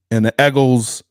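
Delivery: "Eagles" said loud as "Eggles"; "Eagles" punched, first vowel as in "egg"; Eagles like "Eggo". Real, not acted. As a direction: say "Eagles" with a short e, like Eggo waffles. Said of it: "You made me think of Eggo waffles when you say that".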